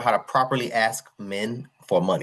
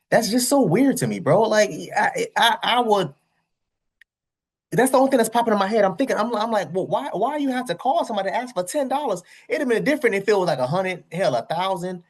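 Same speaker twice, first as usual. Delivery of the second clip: exasperated rant; 9 semitones higher than usual. Real, not acted. Reviewer ranting in disbelief.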